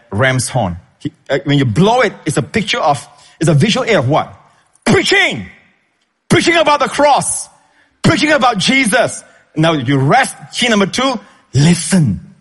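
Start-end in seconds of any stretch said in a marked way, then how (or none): 4.8-9.2 much higher exclaimed preaching; fiery preaching. Acted. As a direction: preach it out with fire. Building preaching climax.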